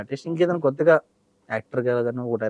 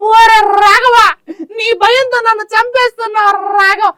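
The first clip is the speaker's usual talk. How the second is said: much higher and louder, laughing-crying wail; high film character voice; 7 dB louder than usual. Pretend. Mimic does a comic film character, high laughing wail. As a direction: wail the name in a squeaky comic voice.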